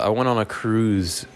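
ordinary talk, nothing marked

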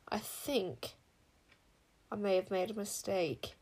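halting, hesitant admission; uncertain. A narrator's reading. Proud queen admitting she miscalculated, uncertain. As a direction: admit it haltingly, with a long pause after the start. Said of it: "said Queen Sycorax uncertainly".

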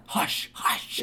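unvoiced, forceful hush; whispered scolding hush; sharp shushing. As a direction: shush a noisy child insistently. Mock shushing the child.

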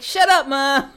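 loud, high shout at mom; shouting teen. Retold or imitated. Boston teen yelling at his mom, a loud high shout. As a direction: yell it like a bratty Boston kid. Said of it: "And he's like".

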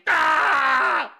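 long, high cry of pretend effort; mock strained cry. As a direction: cry out as if deadlifting. Father straining to lift kid.

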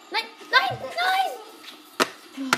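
high, loud cry of no; panicked shout. Real, not acted. Panics as game goes wrong.

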